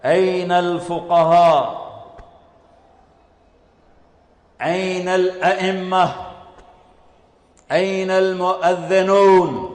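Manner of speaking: slow, stretched-out summons; proclamation. Acted. Announcer summons scholars, imams and muezzins, slow proclamation. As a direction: call out each group in long, sustained syllables. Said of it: "اعلان ہو رہا ہے".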